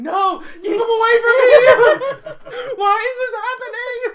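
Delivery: high, loud wailing plea; hammed-up panic breaking into laughter; mock horror. Podcaster imagining being horrified by the movie, wailing mock-terrified cry. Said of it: "crawling into people's laps going".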